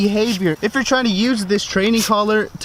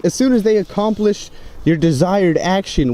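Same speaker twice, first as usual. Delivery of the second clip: ordinary talk, nothing marked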